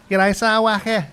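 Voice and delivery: high, loud Scouse greetings, throat tightened; showing the Scouse accent, loud and high. Shown as a demonstration. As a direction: Liverpool Scouse from the back of the throat. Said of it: "That's me Scouse".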